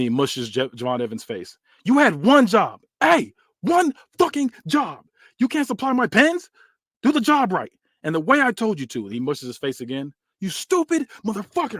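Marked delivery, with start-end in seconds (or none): 1.8-9.0 furious yell. Acted. Orlando screaming at Javon over the missing pin, furious high yell. Orlando berating his lackey. 10.4-11.8 high-pitched venomous jab; contemptuous insult. Acted. Orlando insulting Javon while shoving him, high contemptuous snarl.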